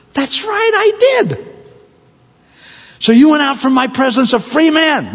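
very high, emphatic put-on voice; indignant master. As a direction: drive the point home at a high pitch. Acted master confronts servant.

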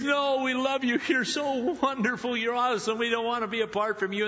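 high, pleading daughters-in-law, a touch of weeping; lightly acted tearful protest, pitched high. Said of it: "And they weep".

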